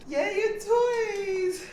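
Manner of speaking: happy tears. Real, not acted. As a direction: choke up with joy. Emotional reunion.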